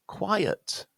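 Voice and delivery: "quiet" high and falling, in two beats; QUIET as two syllables. Shown as a demonstration. Teacher models the second word, "quiet" in two syllables. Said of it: "is a two-syllable word".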